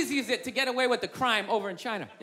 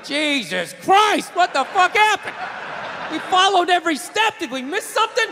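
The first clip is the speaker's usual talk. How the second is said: shocked surgeon; 5 semitones higher, 5 dB louder than usual. Acted. Surgeon staff shocked aside.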